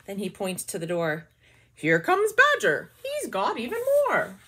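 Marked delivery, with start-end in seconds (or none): 1.7-4.4 high, loud hare voice; cheerful squeaky hare. Acted.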